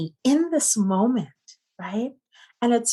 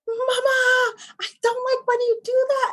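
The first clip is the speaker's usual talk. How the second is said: put-on high, small child's voice; a child holding in his anger, voiced high and small; imitating her young son. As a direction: play the upset little boy, pitched way up. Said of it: "He was like / trying to hold in his anger".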